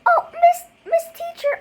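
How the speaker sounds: put-on high, sing-song kid calling "Miss"; whiny kids calling teacher. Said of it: "kids be like".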